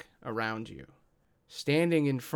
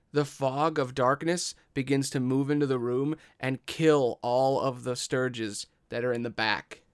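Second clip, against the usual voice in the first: usual speaking voice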